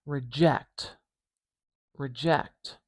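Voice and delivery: Reject, reject spelled out sound by sound; slow careful word demo. Shown as a demonstration. Teacher repeating Reject to show the j. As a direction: model Reject slowly, hearing the j. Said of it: "we've got a j sound".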